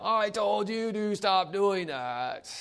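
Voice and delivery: high, slow, loud mock yell at a child; parent yelling. Parent finally yelling at the child who won't stop. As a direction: shout it out, fed up. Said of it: "the next time they may yell".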